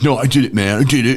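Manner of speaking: mock-indignant whining protest. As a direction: insist you really did it. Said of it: "He was like".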